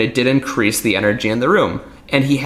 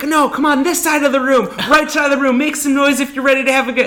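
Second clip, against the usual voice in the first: hype host; 14 semitones higher than usual. Retold or imitated. Magician mocking a hype host's opener, pumped-up emcee voice. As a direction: work the crowd like a cheesy entertainer. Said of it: "So I'd be like".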